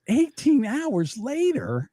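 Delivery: slow, high disbelieving question; incredulous. Real, not acted. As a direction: repeat it in disbelief. Disbelieving repeat of story.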